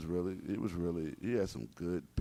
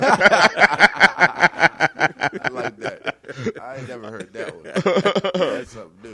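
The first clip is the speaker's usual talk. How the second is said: real laughter breaking through the words, higher pitched; laughing hard; 11 semitones higher, 14 dB louder, slower than usual. Real, not acted. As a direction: crack up while talking. Cracking up at a joke.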